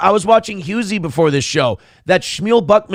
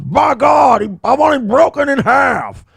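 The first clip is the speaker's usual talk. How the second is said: mock shout, much higher and louder; mock outburst; 8 semitones higher, 4 dB louder than usual. Streamer parodies a rival's rant, high mock shout. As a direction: ham up the rival yelling. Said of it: "And he's like".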